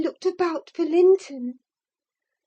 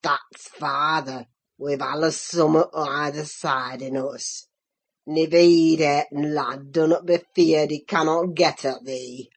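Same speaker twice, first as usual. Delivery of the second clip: croaking Joseph; 14 semitones lower than usual. A narrator's reading. Old Joseph taunts Linton about his father, low mocking croak. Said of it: "he cried".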